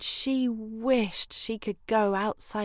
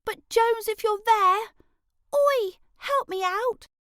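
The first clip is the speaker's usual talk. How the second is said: much higher, louder call for help; 13 semitones higher, 6 dB louder than usual. Acted. Girl calls loudly up and out for Jones. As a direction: yell across the garden for a friend. Calling for her friend.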